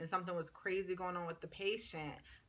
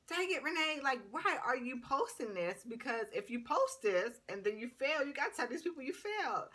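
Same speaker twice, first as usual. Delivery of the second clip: self-scolding; 6 semitones higher than usual. Retold or imitated. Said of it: "I was like".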